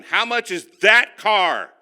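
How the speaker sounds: second ask, now loud and slow; insistent repeat. Retold or imitated. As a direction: demand the price again, firmly. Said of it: "I said".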